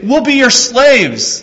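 high, loud pleading cry; groveling brothers. Acted. Brothers grovel before Joseph, high loud plea. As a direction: beg like frightened servants. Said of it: "They say".

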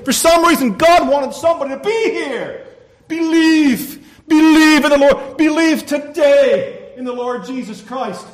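high, loud full-force preaching; impassioned exhortation. Real, not acted. Preacher pleading with someone in church to believe, shouting. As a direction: cry out the call to believe.

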